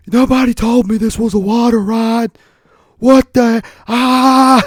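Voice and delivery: high, loud alarmed yell; hammed-up fright rising to a scream; comic panic. Complaining as the water ride boat shakes, mock panicked yelling.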